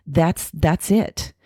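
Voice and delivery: ordinary delivery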